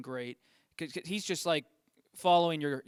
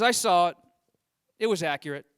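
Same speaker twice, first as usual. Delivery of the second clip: Trump's loud verdict; 8 dB louder than usual. Retold or imitated. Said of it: "He goes".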